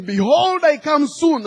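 high, loud acted declaration; hammed-up preacher's ringing announcement; repeated pulpit proclamation. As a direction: proclaim it insistently, stalling for time. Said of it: "the second time he says again".

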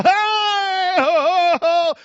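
high, loud church shout of joy; joyful shout. Retold or imitated. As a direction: call out like a dancing congregation. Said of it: "the folk, oh".

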